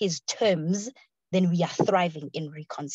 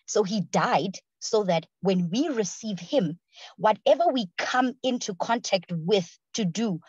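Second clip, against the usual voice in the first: preaching; 2 semitones higher, 4 dB louder than usual. Acted. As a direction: drive the point home with rising passion. Rising preaching passion.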